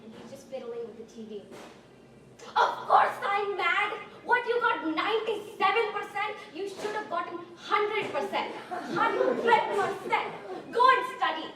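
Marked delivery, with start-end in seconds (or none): 2.5-11.5 high, loud, furious dad impression; dad exploding in anger. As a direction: let the mock-angry father finally erupt. Dad finally explodes over grade.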